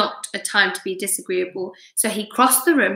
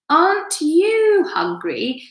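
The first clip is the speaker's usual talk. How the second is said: lady spider, pitched way up and drawn out; sly and sweet, much higher, slowly; 10 semitones higher, slower than usual. A narrator's reading. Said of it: "she asked suddenly".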